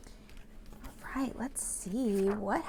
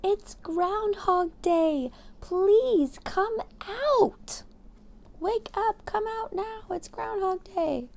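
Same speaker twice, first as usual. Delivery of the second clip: high, louder, slow calling; crowd shout; 11 semitones higher, 4 dB louder, much slower than usual. Acted. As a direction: shout for him to come out. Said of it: "said with a shout".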